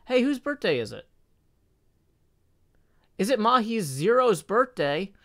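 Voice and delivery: high, bright birthday greeting; excited. Real, not acted. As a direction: greet them like it's a party. Birthday shout-out to viewer.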